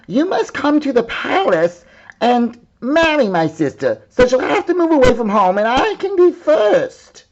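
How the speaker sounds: high, loud demanding princess; bossy princess. Acted. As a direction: command the bear, high and pushy. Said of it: "she said".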